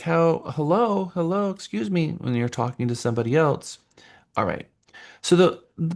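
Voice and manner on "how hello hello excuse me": impatient customer. Retold or imitated. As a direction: call for attention, miffed. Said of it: "they're like".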